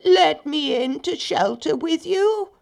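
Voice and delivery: high, slow, drawn-out fake sheep voice; wolf posing as a poor sheep; bleating. A narrator's reading. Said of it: "he bleated".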